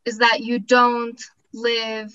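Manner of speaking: ordinary talk, nothing marked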